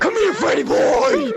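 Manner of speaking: playful chase yell; shout. Pretend. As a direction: yell it like you're chasing someone. Chasing player in game.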